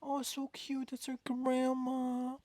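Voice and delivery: high, slow cutesy coo. Real, not acted. Cooing over a cute artwork.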